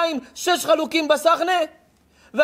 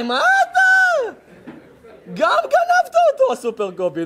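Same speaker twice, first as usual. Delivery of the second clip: much higher, slow, stretched out; mocking disbelief; 12 semitones higher, slower than usual. A preacher ridiculing the boy's fake watch, high and stretched. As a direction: drag it out in mock amazement. Rabbi mocks fake Rolex boy.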